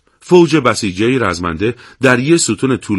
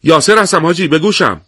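higher, louder eager reply; somewhat excited; 6 semitones higher, 3 dB louder than usual. Acted. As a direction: answer the radio a bit thrilled. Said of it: "قدری هیجان زده".

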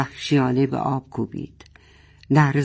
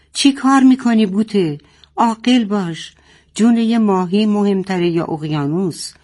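higher, louder angry shout; 6 semitones higher, 3 dB louder than usual. Acted. Father shouting at Boteh to be reasonable, loud. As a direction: shout sense into her. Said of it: "پدر فریاد زد".

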